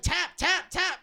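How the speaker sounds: high, loud mock yell of urging; retold group shout. Friends yelling at him to tap out, loud. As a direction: shout the word like cheering someone to give up. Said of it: "and then we're like".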